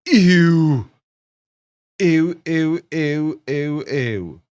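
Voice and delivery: loud, disgusted ews fading. Real, not acted. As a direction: recoil in disgust, then trail off. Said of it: "That's what I say".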